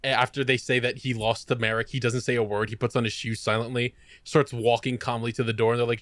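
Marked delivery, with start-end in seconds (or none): none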